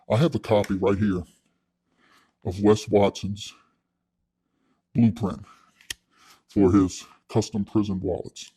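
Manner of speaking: low, soft, slow dramatic reveal; mock presenter. Presenting Wes's wallet blueprint to the audience, slow and hushed. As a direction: unveil the secret document gravely.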